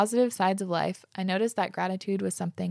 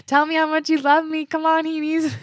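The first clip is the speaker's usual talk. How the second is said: much higher and louder, playfully begging for praise; mock needy demand; 9 semitones higher, 7 dB louder than usual. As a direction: fish for compliments in a hammed-up voice. Just kidding.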